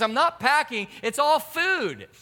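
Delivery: high-pitched mock protest; retold defensive wife. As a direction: imitate a wife defending herself, drawing out the end.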